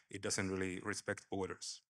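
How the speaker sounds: usual speaking voice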